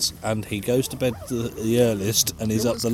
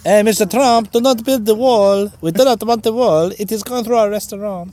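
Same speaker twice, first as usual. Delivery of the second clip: put-on high, loud hammy Italian-sounding accent; bad Mexican-turned-Italian accent; 10 semitones higher, 8 dB louder than usual. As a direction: overdo a comic Italian accent. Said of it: "It's gone Italian".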